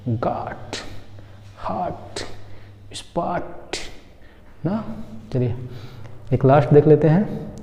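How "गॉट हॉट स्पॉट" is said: soft model words गॉट, हॉट, स्पॉट; गॉट, हॉट, स्पॉट between "aa" and "o"; quiet word-by-word demo of गॉट, हॉट, स्पॉट; rounded open vowel. Shown as a demonstration. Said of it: "चंद्रबिंदी वाला आ होता है".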